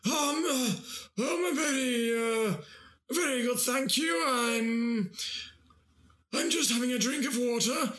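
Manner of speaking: high, slow strained royal voice; Prince Charles impression. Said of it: "A dying seal?".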